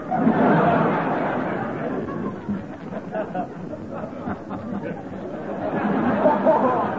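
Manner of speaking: long, breathy, rolling laugh; a long amused laugh at her own joke; hearty laugh. Acted. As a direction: laugh it off at length.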